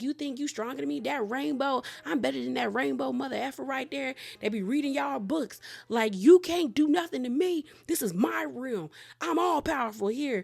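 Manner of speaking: high, taunting villain brag; boastful villain. Retold or imitated. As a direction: gloat like you own this place. Crockett boasting in his realm.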